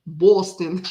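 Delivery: "Boston" with a drawn, rounded Boston vowel; amateur Boston accent. Acted. Trying the town's name for viewers, amateur Boston accent. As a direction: have another go at a Boston accent. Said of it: "I don't know if I'm doing it right".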